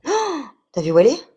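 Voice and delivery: high, loud startled cry; surprised exclamation. Real, not acted. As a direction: blurt it out in shock. Ball suddenly spotted.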